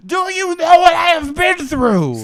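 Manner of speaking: loud, high enraged yell; furious shout. Acted. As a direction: scream out the rage. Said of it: "full of rage".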